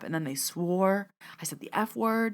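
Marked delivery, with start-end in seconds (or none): 1.4-2.3 hushed whispered aside; whispered confession. Real, not acted. Speaker admitting to listeners she swore, whispered aside. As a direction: whisper it like a naughty admission.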